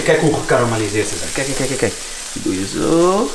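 normal talking voice

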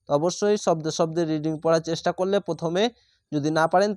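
ordinary talk

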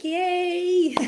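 high, bright cheer of relief; excited yay. Retold or imitated. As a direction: cheer like a weight just lifted. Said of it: "and I'm like".

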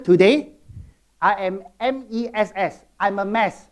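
usual speaking voice

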